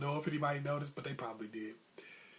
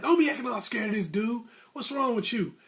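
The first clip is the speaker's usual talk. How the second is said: raised-pitch retold telling-off; scolding coach; 6 semitones higher, 3 dB louder than usual. Coach rebuking his scared fighter, high and exasperated.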